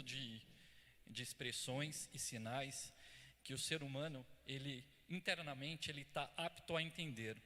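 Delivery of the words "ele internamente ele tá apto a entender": ordinary talk, nothing marked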